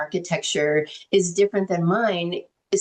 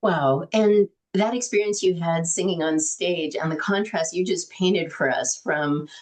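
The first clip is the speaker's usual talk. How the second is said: normal talking voice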